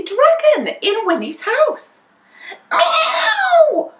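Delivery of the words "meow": high, loud yowling meow; a startled cat yowl; cat cry. A narrator's reading. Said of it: "cried Wilbur".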